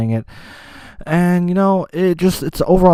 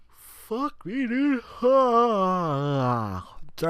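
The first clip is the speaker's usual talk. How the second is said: words through a yawn; 8 semitones higher, 8 dB quieter, much slower than usual. Real, not acted. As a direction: talk mid-yawn. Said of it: "I had to yawn".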